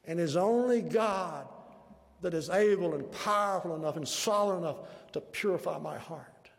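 loud, ringing preacher's declaration; full-force preaching. Real, not acted. Preacher to congregation on clean hands and pure hearts, full-force preaching. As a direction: drive each phrase out loudly, leaning on the key words.

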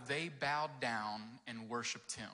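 "They Bowed Down and Worshiped Him." read slowly, each word set apart; slow title reading. Shown as a demonstration. Pastor announcing the title to the kids, slow and deliberate.

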